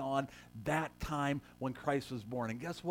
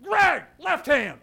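loud, high, slow shout across the stage; drawn-out loud higher call to the actor; shouted correction; 11 semitones higher, 15 dB louder, much slower than usual. Retold or imitated. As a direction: bark the correction at the wise man.

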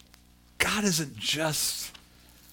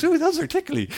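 put-on high, giggly child saying tickly; little boy's voice. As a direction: squeal it like a small kid being tickled by whiskers. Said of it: "gives me this great big hug and goes".

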